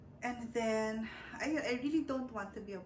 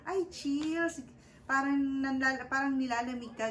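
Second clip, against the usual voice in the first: higher and louder sudden "ay" of realising; surprised realisation; 4 semitones higher, 6 dB louder than usual. Retold or imitated. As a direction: nurse suddenly gets it. Nurse realises she is cold. (gulat na napagtanto ng nurse)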